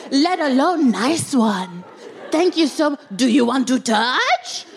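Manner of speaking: hammed-up high flirty voice, slowed and drawn out; put-on flirty reply. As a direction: play the coy admirer over the top. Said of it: "And I replied".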